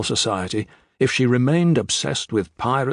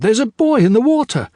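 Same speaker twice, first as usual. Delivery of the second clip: much higher, louder alarmed call; girl spotting something in the sea; crying out; 12 semitones higher, 6 dB louder than usual. A narrator's reading. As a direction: shout to the crew in alarm. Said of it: "she cried".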